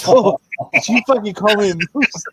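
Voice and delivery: indignant high-pitched character voice; outraged, voice jumping up high; offended high voice. Acted. Player voicing offended character.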